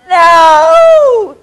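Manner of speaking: sudden loud, high cry of "No!"; shouted no. Retold or imitated. Watching the movie, yelling at the boy, loud cry. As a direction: yell it out at the screen. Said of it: "And of course I went".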